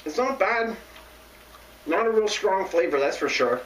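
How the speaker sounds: talking while chewing greens; slightly muffled chewing speech; mouth partly full chewing. Real, not acted. Seen 1: Ate leaf at -4; jaw and lips look mid-chew while holding more.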